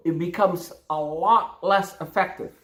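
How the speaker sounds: ordinary talk, nothing marked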